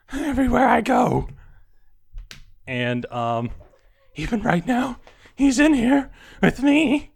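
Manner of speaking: building from hushed to high, loud terror; panicked raving. Acted. Delirious patient describing his stalker.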